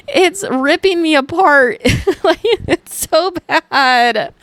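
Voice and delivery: pained laughter over the words, slow and high; laughing through pain. Real, not acted. To listeners about period cramps, laughing in disbelief. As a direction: laugh helplessly while describing cramps.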